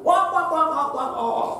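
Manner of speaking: high mock guffaw, wak wak wak; loud laughing. Shown as a demonstration. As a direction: act out a rude loud laugh. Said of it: "tidak tertawa dengan keras". (tawa keras dibuat-buat)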